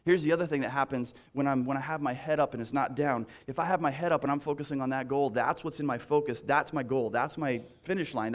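ordinary talk

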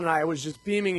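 ordinary talk, nothing marked

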